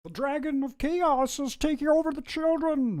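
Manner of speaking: very high, strangled Kermit-like voice; high-pitched doomsaying impersonation; Jordan Peterson. Retold or imitated. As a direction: sound the alarm, high from a closed throat. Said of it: "What kind of stuff does he say?".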